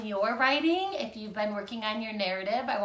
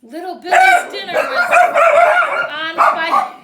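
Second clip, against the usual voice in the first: loud high alarm; alarmed exclamation; 18 dB louder than usual. A narrator's reading. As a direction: sound the alarm. Alarmed story climax.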